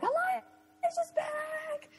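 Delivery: very high, drawn-out imitation of a sister's call; stretched, squealing announcement; mock scream. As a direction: sing it out high and slow. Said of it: "I was screaming my head off".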